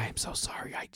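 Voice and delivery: hushed, almost whispered apology; retold tearful apology under the breath; near tears. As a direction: imitate someone close to crying, very softly. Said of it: "she, like, almost started crying".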